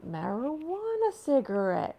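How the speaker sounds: loud, high, easygoing offer; hammed-up host pushing a joint; imitating Joe Rogan. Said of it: "Joe's like".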